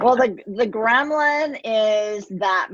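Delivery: slow words through a real laugh; slowed, laughing explanation; laughing while talking. Real, not acted. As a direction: start the answer still laughing.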